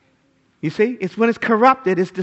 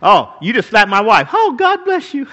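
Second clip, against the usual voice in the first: mock meek; 3 semitones higher, 6 dB louder than usual. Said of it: "Jesus isn't saying".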